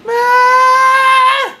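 long, high, very loud wail; wild mock scream. Podcaster echoing co-host's Mad Hatter jibe, screaming crazily. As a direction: scream it out, hugely drawn out.

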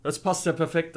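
ordinary delivery

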